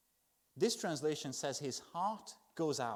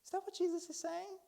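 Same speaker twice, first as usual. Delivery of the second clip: much higher, mock disbelief; incredulous question; 14 semitones higher than usual. Shown as a demonstration. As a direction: ask it like the idea is absurd. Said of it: "By the tone of my voice".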